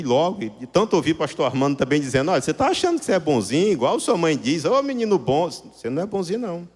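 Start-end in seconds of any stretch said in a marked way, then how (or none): none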